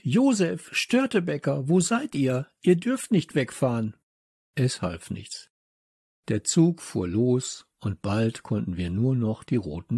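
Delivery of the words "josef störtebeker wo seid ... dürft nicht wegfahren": high, urgent calling. A narrator's reading. Child searching the platform for departing friends, calling out. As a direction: call after them, pitched well up. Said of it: "rief ich".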